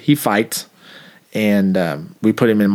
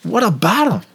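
high mock reply; 10 semitones higher than usual. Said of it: "I said".

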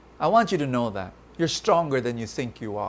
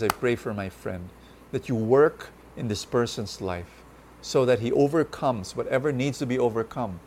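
prayer; 4 semitones lower than usual. Acted.

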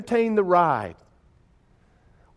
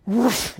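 rushing whoosh of air; imitated whoosh; 6 semitones higher than usual. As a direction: make the sound of a coaster plunging. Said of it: "all of a sudden the whoosh comes".

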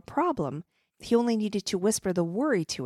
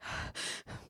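tearful gasp; 13 dB quieter than usual. Acted. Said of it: "tearfully mumbled".

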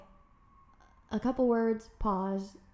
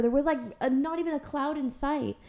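ordinary delivery

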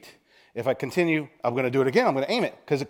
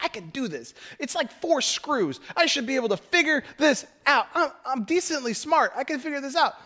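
high-pitched mock indignation; stubborn self-assurance; 11 semitones higher than usual. Preacher mocking himself over four screws, indignantly stubborn. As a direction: insist squeakily that you can manage it. Acts out his stubborn pride.

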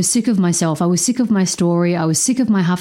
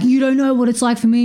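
higher, fast mock outburst; retold snap; 6 semitones higher, faster than usual. Retelling her fight with her partner, fast and raised. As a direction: fire it back quick and high. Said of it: "And then I'm like".

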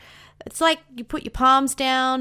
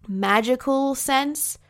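usual speaking voice

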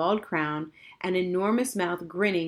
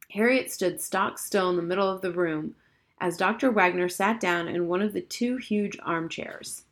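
usual speaking voice

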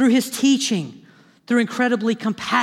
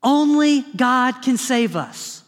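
ordinary talk, nothing marked

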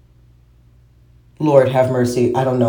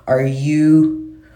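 ordinary talk

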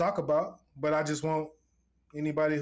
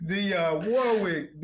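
drawn-out name; 3 semitones higher, 3 dB louder, much slower than usual. Real, not acted.

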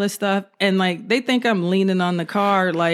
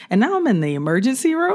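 ordinary talk

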